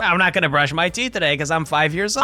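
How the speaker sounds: mock indignation at wife; offended mock retort. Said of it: "I'm like".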